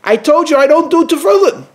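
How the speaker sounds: high, loud, irritated snap back; the man shouting at the boy, irritated and loud; upset loud retort. Retold or imitated. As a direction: bark it back, fed up with the question. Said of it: "he spoke loud and got a little bit upset".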